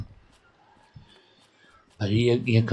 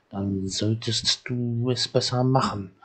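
slow and deliberate, each word weighed; slow reading. Acted. Game elder telling the child to fix the boat, slowly. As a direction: read it out slowly like a stern order. Same line read twice, slow then fast.

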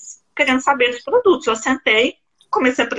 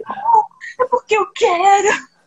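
crying answer; 11 semitones higher, slower than usual. Retold or imitated. To the man asking why she cries, a high sobbing reply. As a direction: voice cracking way up into a sob. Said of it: "eu chorando muito".

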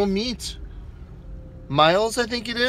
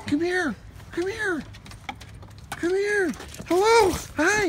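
much higher, cooing puppy voice; 11 semitones higher, 4 dB quieter than usual. Real, not acted. Calling the dog over.

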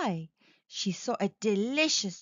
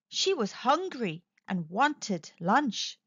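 ordinary delivery

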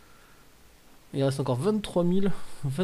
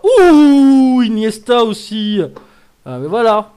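long high whoop into loud delight; whoop, then shout with delight; thrilled shout; 6 semitones higher, 15 dB louder, slower than usual. Real, not acted. Streamer finding Iniesta too, thrilled shouting. Second big card appears.

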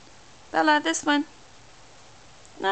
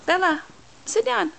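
sharp, high-pitched parent command; firm command; 8 semitones higher than usual. Real, not acted. Mother stopping toddler wandering off.

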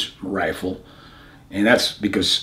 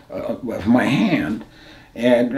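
ordinary delivery

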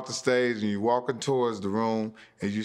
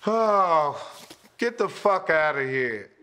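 loud, higher heckler yell; imitating a heckler; 6 semitones higher, 4 dB louder, slower than usual. Comic mimicking a rude heckler, mock yell. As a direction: jeer him off like a drunk. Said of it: "and then you hear".

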